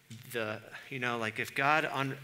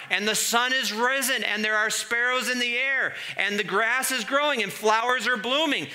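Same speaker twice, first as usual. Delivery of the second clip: much higher and louder despairing wail; hammed-up hopeless lament; desperation; 11 semitones higher, 11 dB louder than usual. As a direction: melodramatic despair, near shouting.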